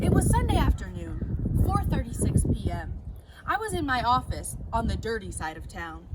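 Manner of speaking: punchy noir storyteller voice; hammed-up hard-boiled narrator; detective narration. A narrator's reading. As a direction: read it like a tough private eye.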